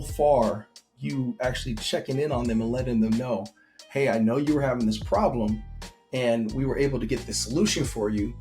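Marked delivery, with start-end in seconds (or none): none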